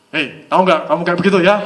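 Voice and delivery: loud, surging scolding demo; agitated tone. Shown as a demonstration. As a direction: snap it out loudly with a jolt in the tone. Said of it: "tidak boleh gejolak nadanya ya".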